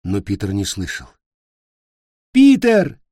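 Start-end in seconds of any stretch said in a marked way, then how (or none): 2.3-3.0 high, loud desperate shout. Acted. Cricket screaming for Peter over the noise. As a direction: scream his name to reach him. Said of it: "закричал Сверчок".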